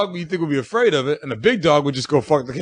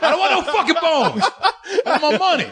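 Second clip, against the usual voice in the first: mock angry refusal; 10 semitones higher than usual. Kangaroo refusing bones.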